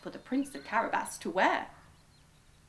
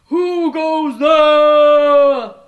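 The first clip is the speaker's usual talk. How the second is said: very slow, very loud monster bellow; booming ogre shout; 6 semitones higher, 21 dB louder, much slower than usual. A narrator's reading. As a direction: boom it out like a giant ogre. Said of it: "it shouted".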